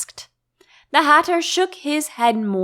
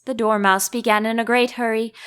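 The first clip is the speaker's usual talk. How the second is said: ordinary delivery, nothing marked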